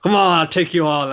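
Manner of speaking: hammed-up brash call to fight them all; shouting a dare at the whole group; mock challenge. Itadori challenging everyone, retold.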